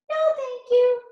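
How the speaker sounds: high, polite pig voice; polite little pig. A narrator's reading. Pig politely refusing the wolf, high. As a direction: turn the wolf down sweetly as the pig. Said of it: "said the pig".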